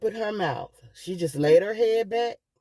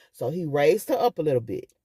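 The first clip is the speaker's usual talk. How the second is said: normal talking voice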